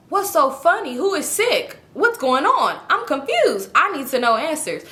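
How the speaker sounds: high-pitched, insistent questioning; exasperated demands. Acted. Acted stressed demands for answers.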